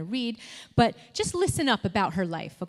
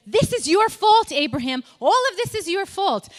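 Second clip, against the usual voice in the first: loud, high-pitched acted blame; wife's mock outburst, each accusation pushed high and loud; accusing; 8 semitones higher, 7 dB louder than usual. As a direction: hurl the blame at your husband. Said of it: "and she blames him".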